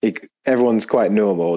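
ordinary delivery

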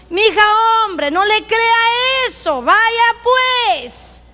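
mock soothing sister. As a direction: coax her along slowly. Mimicking church gossip women.

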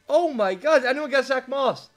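ordinary delivery

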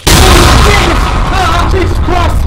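very high panicked shrieks; terrified screaming. Real, not acted. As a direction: scream in terror. Fleeing in terror.

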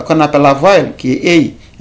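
ordinary delivery

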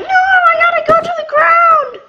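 high, loud mock panic as the character falls. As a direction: squeal it out in pretend alarm. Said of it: "And I'm all like".

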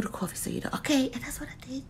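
hushed aside to the camera; quick whispered confession. Real, not acted. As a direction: whisper it like a secret.

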